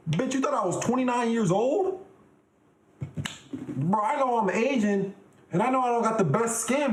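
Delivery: high-pitched, incredulous indignation; mock outrage. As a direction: act wildly offended, pitch way up. Mock outrage at being called twenty-nine.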